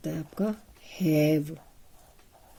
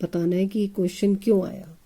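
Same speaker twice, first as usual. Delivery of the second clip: usual speaking voice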